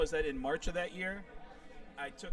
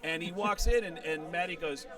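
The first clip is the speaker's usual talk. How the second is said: ordinary talk, nothing marked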